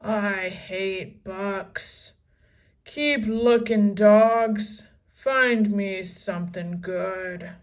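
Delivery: slow then loud barking; disgusted pirate captain. A narrator's reading. Captain hating books, slow disgusted growl then bark. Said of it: "He spit on the sand again".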